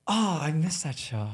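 normal talking voice

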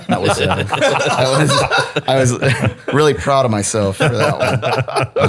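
words broken up by laughter; cracking up at own joke; laughing while talking. Real, not acted. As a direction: talk through a proud laugh.

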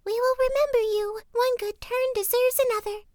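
very high, squeaky little bird voices; chicks crying thanks. A narrator's reading. Young ravens thank the youth in very high chick voices. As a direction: chirp the promise gratefully. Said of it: "and cried".

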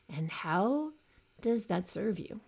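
ordinary talk

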